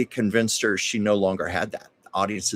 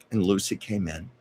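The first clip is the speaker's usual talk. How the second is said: normal talking voice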